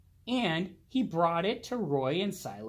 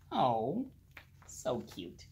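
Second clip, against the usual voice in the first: soft aside; 6 semitones lower, 7 dB quieter, slower than usual. Real, not acted. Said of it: "reader's aside".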